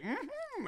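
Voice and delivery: very high, soft muffled "mm-hmm"; closed-mouth hum. Retold or imitated. Imitating a man hiding his glowing teeth on a date, closed-mouth hum. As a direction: squeak out agreement through shut lips. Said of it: "holding it like this".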